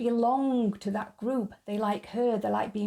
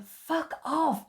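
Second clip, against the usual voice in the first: a lightly voiced Brian, higher and blunt; Brian telling followers off; 7 semitones higher than usual. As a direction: bark it at the crowd trailing you. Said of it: "he says to them".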